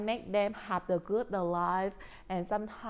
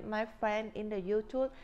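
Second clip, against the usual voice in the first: ordinary delivery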